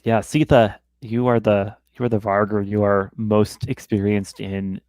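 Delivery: ordinary talk, nothing marked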